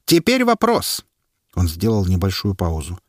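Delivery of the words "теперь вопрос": slow, high announcing voice; herald. A narrator's reading. Herald asking the crowd, slow and high. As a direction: proclaim it to the crowd.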